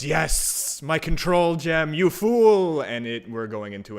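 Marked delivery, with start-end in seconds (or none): 0.0-2.8 high hissing monster cry rising to a shout. Acted. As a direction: hiss it out, building louder and higher. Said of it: "it will cry out in a hissing voice".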